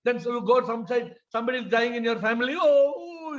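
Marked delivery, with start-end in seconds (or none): 2.6-3.4 high drawn-out fake wail; hammed-up mourning cry; mock crying. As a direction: imitate a grieving relative sobbing. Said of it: "you are crying".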